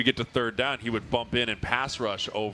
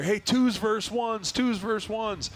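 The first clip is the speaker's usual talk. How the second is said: higher, slow drawn-out call across the field; coach calling out; 10 semitones higher, slower than usual. Retold or imitated. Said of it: "all of a sudden I hear".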